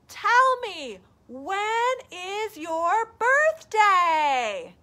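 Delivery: high, loud, slow call. Acted. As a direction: call slowly up to the moon. Bear calling to moon.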